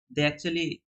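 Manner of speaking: ordinary talk, nothing marked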